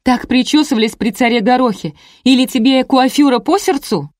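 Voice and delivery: high, scornful questioning; indignant shriek. A narrator's reading. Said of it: "взвизгнула собеседница". (возмущённо, с издёвкой)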